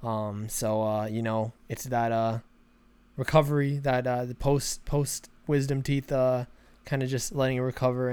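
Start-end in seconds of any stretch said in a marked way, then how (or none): none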